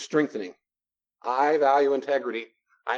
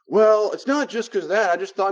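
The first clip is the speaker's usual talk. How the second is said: higher, louder mock protest; defensive protest; 7 semitones higher, 5 dB louder than usual. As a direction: protest as the other person, raised pitch. Acting out the defensive other person.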